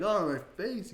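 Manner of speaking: high, mock-pained groan; playful face-ache complaint; sore-faced viewer. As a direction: play a viewer whose cheeks ache. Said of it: "You're like".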